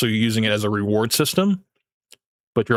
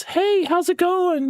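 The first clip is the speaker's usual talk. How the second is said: put-on much higher, slower, thin girly voice; no-bass simp voice; slower than usual. Shown as a demonstration. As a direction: squeak it out like a timid simp. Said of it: "but you sound like this".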